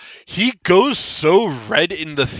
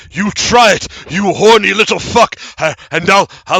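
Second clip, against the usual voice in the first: loud, threatening little halfling; feisty little halfling; 5 semitones higher, 7 dB louder than usual. Acted. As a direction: sputter threats as a cornered halfling. Game master voices the halfling.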